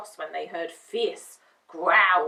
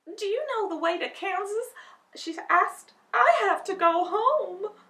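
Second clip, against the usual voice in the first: put-on young girl Dorothy; 11 semitones higher, 6 dB louder than usual. Dorothy asks the way home.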